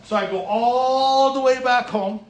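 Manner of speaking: drawn-out exasperation at the wasted trip; exasperated retelling. Retold or imitated. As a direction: let the frustration of the long trip back ring out.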